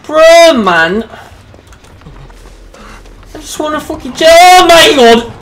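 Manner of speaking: very high, loud wail of frustration; exasperated yell. Real, not acted. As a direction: cry out in real exasperation, building to a yell. Exasperated at losing the killer's chase.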